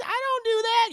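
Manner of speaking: high, loud indignant squeal; mock Hattie denial; high Hattie protest. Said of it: "She says".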